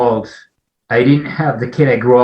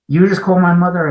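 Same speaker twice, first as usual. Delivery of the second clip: higher, disbelieving; can't believe what he hears; incredulous friend; 8 semitones higher, 3 dB louder than usual. Retold or imitated. Old friend calling back, stunned he phoned his mother, higher voice. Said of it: "He says".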